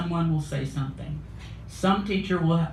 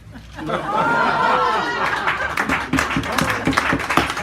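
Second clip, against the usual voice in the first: crowd laughing; 6 dB louder than usual. Real, not acted. Audience laughter.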